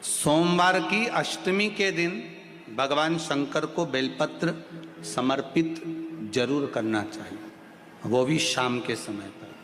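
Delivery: soft, slow, measured teaching; hushed, unhurried preacher; calm devotional instruction. Real, not acted. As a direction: give ritual instructions quietly, with pauses between phrases.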